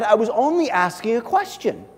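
high-pitched self-defence of a younger brother; defensive protest. A narrator's reading. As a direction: plead innocence, voice lifted high. Acted protest of the scolded brother.

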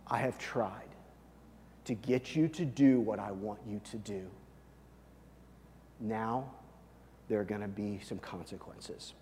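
low, hushed, measured ultimatum; soft, deep-pitched divine warning with weighty pauses; grave final warning. Acted. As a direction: drop down low and quiet, patience used up. God's final warning to Pharaoh.

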